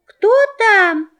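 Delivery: high small voice; small kids asking who's there; kid goat voice. Acted. Kids ask at the knock.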